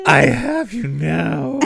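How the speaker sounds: slow, put-on villain line; mock-menacing, stretched-out delivery; Darth Vader. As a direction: draw it out like a movie villain. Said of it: "bad imitations of Darth Vader".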